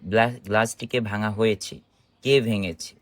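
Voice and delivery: ordinary talk, nothing marked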